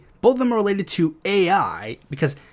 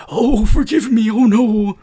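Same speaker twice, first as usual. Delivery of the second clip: higher, louder, slow hammed-up fake contrition; mock pleading; 5 semitones higher, 6 dB louder, slower than usual. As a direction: ham it up like begging for mercy. Mocking fake guilt if caught.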